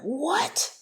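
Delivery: high-pitched, rising disbelief; shocked. Retold or imitated. Teller recalls hearing about the squirrel, shocked. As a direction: blurt it out, voice shooting up. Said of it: "damn near had a heart attack".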